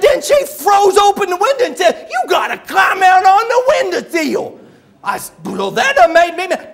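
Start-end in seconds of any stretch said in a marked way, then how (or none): 0.0-4.6 high, loud agitated telling; worked-up mock shouting, words garbled; Buddy. Buddy tells of being ordered onto the windowsill, shouting. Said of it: "when he gets real worked up".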